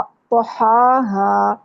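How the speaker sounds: توہاہا very slow, the و made with rounded lips; correct pronunciation. Shown as a demonstration. Teacher showing students how to say توہاہا, very slowly. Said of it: "اس لفظ کو ٹھیک نہیں پڑھا".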